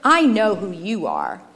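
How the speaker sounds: dark, emphatic charge; angry accusation. A narrator's reading. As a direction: condemn him with deliberate force. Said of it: "looked at firstborn with anger".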